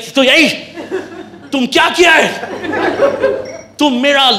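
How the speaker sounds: shout, building to a laughing wail; hammed-up outraged Brit; outraged complaint.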